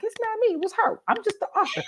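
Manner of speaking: high-pitched, pleading self-defence; mock innocence. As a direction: squeak out an excuse, blaming someone else. Defends herself to readers.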